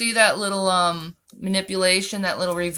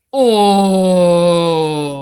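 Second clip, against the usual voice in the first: loud oh; 3 semitones lower, 10 dB louder than usual. Real, not acted. Reacting to a cutting comment.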